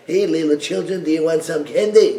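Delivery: lightly voiced sleazy lure; a hint of a creepy candy man; creepy stranger. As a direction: sweet-talk kids into a trap. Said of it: "pedophile goes like".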